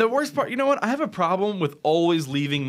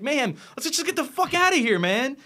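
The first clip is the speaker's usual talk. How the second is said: high-pitched, exasperated urging; retold urgent plea; 10 semitones higher than usual. Retelling her plea to Mayhem at gunpoint, high urgent pleading. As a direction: re-enact begging a friend to flee.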